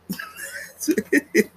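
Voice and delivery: squealing high burst of laughter. Real, not acted.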